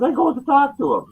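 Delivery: bursting with excitement; telling friends he'll approach the star, high and giddy. Real, not acted. Giddy retelling after laughing.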